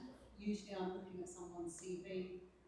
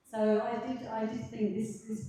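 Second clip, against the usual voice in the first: louder, pushing the voice out; speaking up; 4 semitones higher, 9 dB louder, slower than usual. Real, not acted. Reviewer speaking up for the panel, a louder push. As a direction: project so the panel can hear. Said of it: "but I'll try and speak louder".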